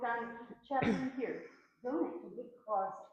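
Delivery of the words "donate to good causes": usual speaking voice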